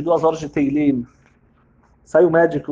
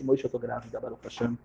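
hushed joy; 7 semitones lower, 13 dB quieter than usual. Acted. Wife tells husband she's pregnant.

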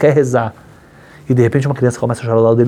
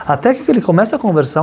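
normal talking voice

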